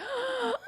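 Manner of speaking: a sharp, high, breathy intake of surprise; a soft, high startled gasp; mock gasp. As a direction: gasp like seeing a hot guy on TV. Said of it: "I was like".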